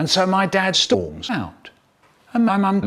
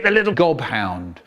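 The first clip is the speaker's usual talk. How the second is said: ordinary delivery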